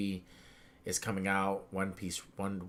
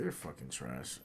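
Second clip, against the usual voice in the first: deflated mutter; 10 semitones lower, 11 dB quieter than usual. Retold or imitated. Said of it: "I was like".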